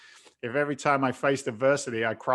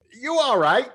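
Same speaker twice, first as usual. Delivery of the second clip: loud, high-pitched disbelieving question; comic are-you-nuts reaction; incredulous; 11 semitones higher, 7 dB louder than usual. Retold or imitated. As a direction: ask as if they must be crazy, voice raised.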